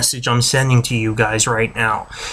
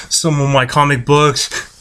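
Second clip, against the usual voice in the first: ordinary talk, nothing marked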